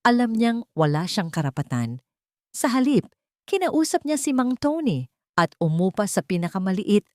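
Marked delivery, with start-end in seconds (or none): none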